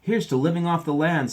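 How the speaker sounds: high, loud proud toast; hearty toast. A narrator's reading. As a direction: raise a glass boldly. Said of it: "said Papa".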